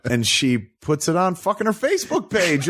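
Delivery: ordinary talk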